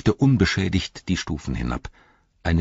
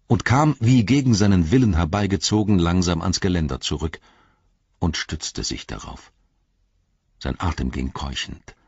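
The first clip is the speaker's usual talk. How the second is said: usual speaking voice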